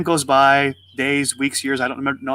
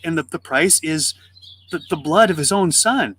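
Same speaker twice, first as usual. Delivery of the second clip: ordinary talk, nothing marked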